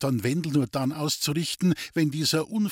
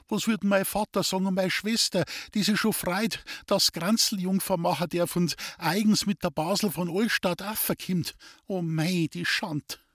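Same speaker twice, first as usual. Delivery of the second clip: higher lamenting wail; mildly wailing girl; 7 semitones higher than usual.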